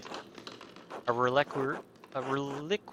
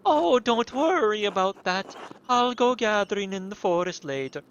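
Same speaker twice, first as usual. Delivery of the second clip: sister voice; 6 semitones higher, 6 dB louder than usual. Acted. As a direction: gently wave off the offer, high. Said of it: "these fucking wild accents".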